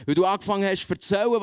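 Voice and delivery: ordinary talk, nothing marked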